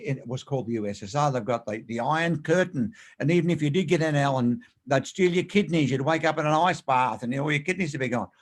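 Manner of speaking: ordinary delivery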